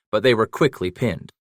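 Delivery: plain reading voice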